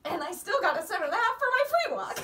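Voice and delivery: loud, high, quick and gleeful; laughing boast. Real, not acted. Rider telling a friend about the retired test, real laughing boast. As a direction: crow it through a laugh.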